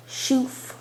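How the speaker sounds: ordinary delivery, nothing marked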